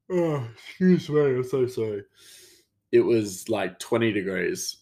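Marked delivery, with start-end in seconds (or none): 0.1-2.0 high, yawny apology; words caught in a yawn; talking through a yawn. Real, not acted. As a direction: apologise while stifling a yawn. Apologises mid-yawn, as again later.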